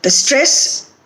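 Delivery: usual speaking voice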